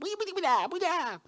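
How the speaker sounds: high squeaky goblin chatter. Retold or imitated. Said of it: "one of the voice actors was doing a goblin".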